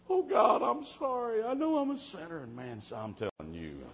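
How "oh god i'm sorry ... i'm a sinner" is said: high, pleading cry to God; imitated tearful prayer. Girl holding the preacher's hand to pray, imitated tearful confession. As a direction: mimic a weeping girl's confession. Retold crying girl praying for salvation.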